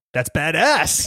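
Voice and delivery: loud high hammed-up approval; mock badass verdict. Joking with cohost about the judge, loud mock approval. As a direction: play the dude impressed, loudly.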